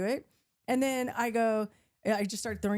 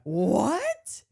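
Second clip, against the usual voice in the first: sharp, louder indignant "What?"; 6 dB louder than usual. Retold or imitated. Retelling the fight, a loud offended what. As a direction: snap back in disbelief. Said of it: "All of a sudden I turned into a monster".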